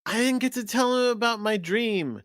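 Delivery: high-pitched mock girl's complaint; retold girl's lament. Girl misses telling him her dream, high imitated lament. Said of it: "And then she goes".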